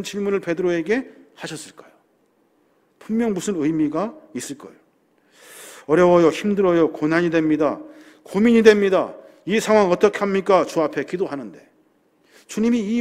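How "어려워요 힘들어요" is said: usual speaking voice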